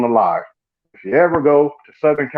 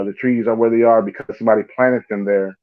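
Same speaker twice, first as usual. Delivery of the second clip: ordinary delivery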